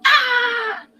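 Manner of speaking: high, long held "ah"; imitated scream. Dreamer imitates screams after the portal closes, high mock scream. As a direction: mimic a distant victim's high scream. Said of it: "Then the screams come".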